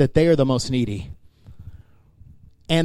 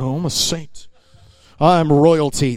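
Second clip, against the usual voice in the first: puffed-up, self-important boast; mock arrogance; slower than usual. Preacher mimicking a boastful believer, arrogant. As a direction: say it as if showing off your rank. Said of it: "walk around arrogantly going".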